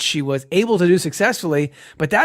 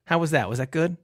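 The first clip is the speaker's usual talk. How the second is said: usual speaking voice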